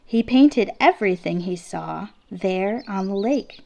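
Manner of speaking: usual speaking voice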